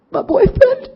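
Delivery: high, loud mock sob of a crying woman; mock crying wail. Imitating the crying woman at the meeting, high hammed-up wail. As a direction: wail it like a hammed-up weepy girlfriend.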